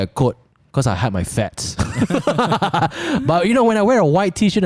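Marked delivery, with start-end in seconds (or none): 1.8-4.6 laughing at herself; giggling through the words. Real, not acted. Laughs about own looks.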